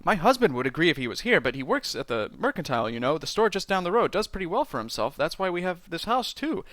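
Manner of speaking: gushing, breathless run-on prattle; talking without breathing. Acted. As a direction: rattle it off as if on one breath. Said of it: "I took a breath. I, I can't do it".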